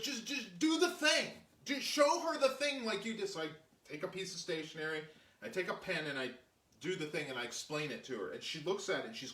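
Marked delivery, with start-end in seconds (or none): 0.0-3.3 high-pitched imitated urging; urgent prodding. Julia urging him to show the executive, imitated high urgency. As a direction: push them to get on with it.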